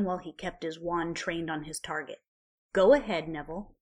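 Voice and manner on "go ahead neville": savage dare. A narrator's reading. Ron daring Neville.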